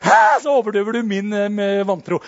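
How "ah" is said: loud yelled scream. Shown as a demonstration. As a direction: shout it out. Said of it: "Skrik litt høyere du også". (høyt skrik)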